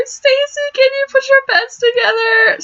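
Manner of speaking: very high small squeaky voice; squeak. Acted. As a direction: squeak it out timidly like Mari. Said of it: "Mari squeaks".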